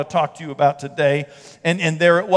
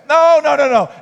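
higher, louder repeated no; emphatic no; 7 semitones higher, 6 dB louder than usual. Real, not acted. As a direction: wave off the idea firmly. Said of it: "pastor reassuring emphatically".

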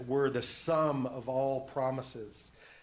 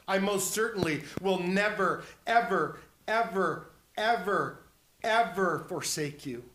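loud, raised, each "ever" punched separately; utterly emphatic; 4 semitones higher, 5 dB louder than usual. Acted. Preacher voicing Christ's promise never to forsake you, emphatic. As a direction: hammer "never, ever, ever, ever, ever" one by one. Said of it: "He just could not be more emphatic".

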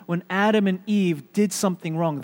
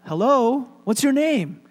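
higher, louder, friendly phone greeting; telemarketer to child; 7 semitones higher, 5 dB louder than usual. Acted. Telemarketer asks a little boy his name, pitched up. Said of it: "And the telemarketer said".

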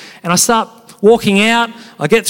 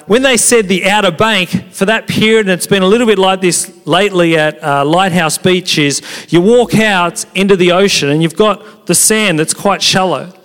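ordinary talk

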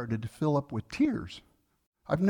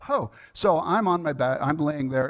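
usual speaking voice

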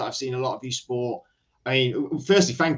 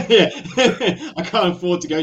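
laughing loudly, high and bright; 6 semitones higher, 6 dB louder than usual. Real, not acted. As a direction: burst out laughing. Laughing at the plug.

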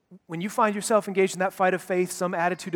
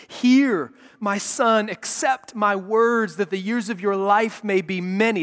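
a father's urgent plea, louder and slow; 3 semitones higher, 5 dB louder than usual. Shown as a demonstration. Father pleading with his son about danger, urgent and loud. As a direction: pour a dad's seriousness into every word. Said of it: "a dad speaking to his son, trying to muster up all of the seriousness and urgency".

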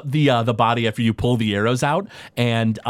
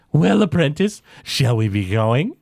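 professor's voice. Acted.

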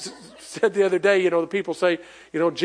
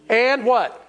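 ordinary delivery

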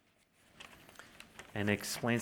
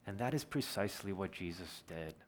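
usual speaking voice

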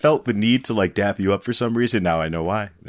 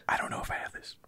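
whisper; 16 dB quieter than usual. Pretend. Nervous reply in mock pep talk.

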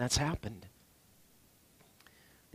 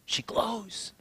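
whisper; 5 semitones higher, 5 dB quieter than usual. Acted. Preacher marvels at bride.

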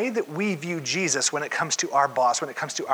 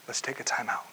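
much softer, easy and kind; Jesus quietly telling his disciples to take a time out; gentle time out; 4 semitones lower, 8 dB quieter than usual. Acted. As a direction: call the break gently. Jesus calling a break.

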